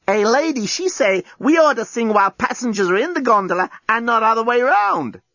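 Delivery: lightly voiced chatty robot; broken English robot chatter; gondola robot. A narrator's reading. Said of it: "confided the gondola bot".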